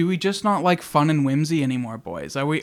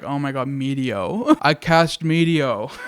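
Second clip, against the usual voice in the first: "I cast meteo" said slowly, laughing and louder; laughing delight; 6 dB louder, slower than usual. Retold or imitated. A player casting the silly meteor spell, laughing. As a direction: laugh through "meteo" like it's the best spell ever. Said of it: "and you're like".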